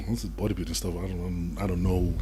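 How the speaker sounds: low, soft, clueless wondering; puzzled mumble. Retold or imitated. Young man answering partner about bodybuilding, baffled mumble. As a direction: mutter it, baffled by the word.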